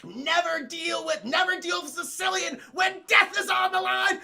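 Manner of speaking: high, loud crowing villain; hammed-up gloating proclamation, building to a shout; Vizzini. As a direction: crow it in mock triumph. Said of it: "I could make a good Vizzini".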